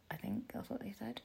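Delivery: unsure whisper to herself; whispered aside. Real, not acted. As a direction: whisper it, half to yourself.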